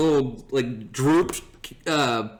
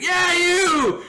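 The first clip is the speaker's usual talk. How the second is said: high, drawn mock holler; imitated drunken shout; 4 dB louder than usual. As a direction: shout across the room as the drunk buddy. Drunk friend calling to announcer.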